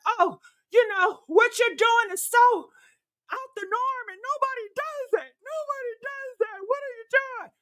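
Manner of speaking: high, shocked mocking tone; mock hater voice. Mocking haters shocked by her style, high and scandalized.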